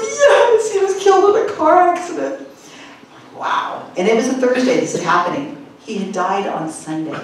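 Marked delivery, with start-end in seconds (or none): 0.0-2.5 high, wobbly mock tearful answer; tearful believer. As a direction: imitate a crying young woman, hammed up. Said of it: "So she's like".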